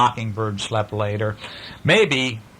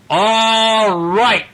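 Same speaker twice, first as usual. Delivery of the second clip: louder, slow stern father; firm dad; 7 semitones higher, 4 dB louder, much slower than usual. Acted. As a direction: stop the kids' fight for good. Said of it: "until Daddy said".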